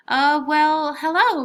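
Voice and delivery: high, loud, startled stammer; flustered. Acted. Lily caught by the angry homeowner, flustered and startled. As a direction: caught out and scrambling.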